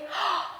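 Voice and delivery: sharp acted intake of breath; storybook gasp of amazement; surprised gasp. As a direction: gasp at an unexpected sight. Father spots his son.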